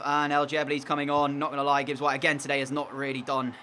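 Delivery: normal talking voice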